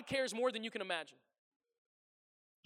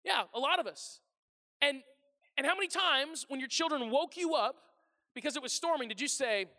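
normal talking voice

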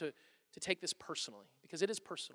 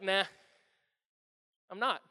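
calm, offhand denial; easy, understated refusal; unbothered; 4 semitones lower than usual. Acted. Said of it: "he didn't get defensive".

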